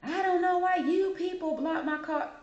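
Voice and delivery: high-pitched imitation of a yelling caller; mock angry customer. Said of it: "yell, and scream".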